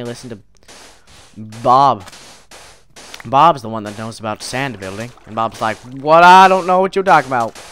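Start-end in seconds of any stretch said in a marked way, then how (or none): 5.8-7.6 mock loud bewildered denial; hammed-up indignation; acted protest. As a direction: protest loudly as the startled friend. Voicing Bob's protest in story.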